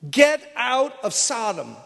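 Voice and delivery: slow, emphatic warning; acted urgent command. Angels warning Lot to leave, emphatic acted command. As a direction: land each word as an urgent order.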